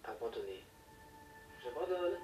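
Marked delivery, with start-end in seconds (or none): 1.6-2.2 shouted in frustration; loud childish yell; shouting. Retold or imitated. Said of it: "j'ai crié".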